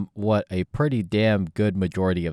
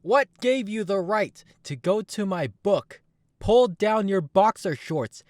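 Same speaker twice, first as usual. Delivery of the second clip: furious high outburst; 13 semitones higher, 4 dB louder than usual. Acted. Jung scolds the fans for defiling his book, high loud outrage. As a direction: scold them like vandals.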